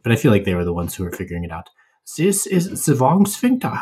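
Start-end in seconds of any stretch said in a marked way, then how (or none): 2.2-3.7 hammed-up German accent, much higher and slow; bad German accent. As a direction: ham up a mock German voice, stretched out. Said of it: "that's my bad German accent".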